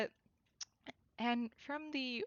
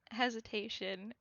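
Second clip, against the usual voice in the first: usual speaking voice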